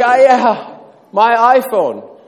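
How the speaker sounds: high, loud, slow wail over the iPhone; mock lament. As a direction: cry out like a heartbroken dad. Father laments again.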